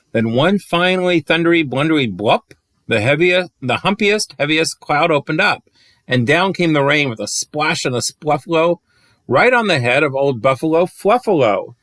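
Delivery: put-on lilting read-aloud rhyme for kids; bouncy rhyming storyteller voice; storybook reading. A narrator's reading. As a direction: read it like a bedtime picture book. Reads picture book aloud.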